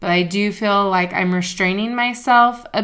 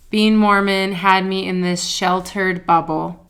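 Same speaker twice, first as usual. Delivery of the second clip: normal talking voice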